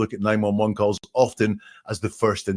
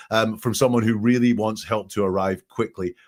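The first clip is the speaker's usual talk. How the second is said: normal talking voice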